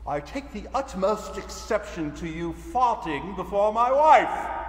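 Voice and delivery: hammed-up British accent, building to a loud, high finish; mock-outraged British accent, shouting the last words. Shown as a demonstration. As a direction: do an indignant, over-the-top British accent. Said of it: "a sample of my British accent".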